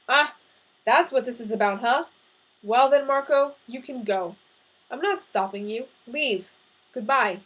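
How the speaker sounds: loud, angry dismissal; mad as hell. A narrator's reading. Father dismisses son who wants to leave, angry outburst. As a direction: throw him out with curt anger. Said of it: "mad as hell".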